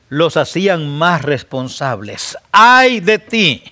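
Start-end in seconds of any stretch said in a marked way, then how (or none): 2.5-3.6 "Ay de ti" slow, high and loud; solemn slow woe. Shown as a demonstration. Preacher intoning Christ's woe slowly and loudly. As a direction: draw out each word of "Ay de ti". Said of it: "repeated woe".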